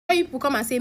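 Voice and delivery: plain reading voice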